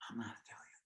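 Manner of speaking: breathy, hushed "I'm not Italian." with stress on "not"; quietly stunned, barely voicing "I'm not Italian."; stunned whisper. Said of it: "Now I go to Italy and I'm like".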